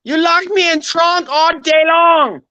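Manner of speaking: very high, hammed-up angry film villain; Mister Wong. Shown as a demonstration. Film gangster accusing his captors, high mock-furious impression. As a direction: shriek the accusation in a comic put-on accent. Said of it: "Mister Wong from the fucking".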